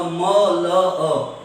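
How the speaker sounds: M L A as muh, luh, uh; wrong phonic reading. Shown as a demonstration. Teacher gives the wrong example about uncle, muh-luh-uh for M L A. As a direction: read M L A by consonant sounds, muh-luh-uh. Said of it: "No, we say he is an".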